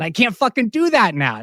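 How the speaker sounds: high, loud burst of frustration; exasperated yelling. Real, not acted. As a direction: yell it out, fed up at being stuck. Venting at lost live shows.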